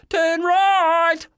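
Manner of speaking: high loud shout; yell. A narrator's reading. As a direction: yell it out in panic. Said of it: "I yelled".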